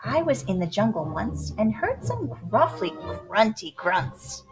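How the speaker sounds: ordinary delivery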